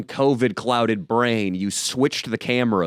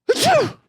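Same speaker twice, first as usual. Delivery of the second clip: fake sneeze; 3 dB louder than usual. Acting out sneeze fit on date.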